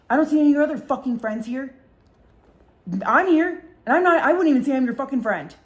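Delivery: high, loud indignant outburst; heated, punchy re-enacted rebuke; retold angry confrontation. She confronts friend in retold quarrel.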